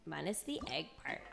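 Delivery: ordinary talk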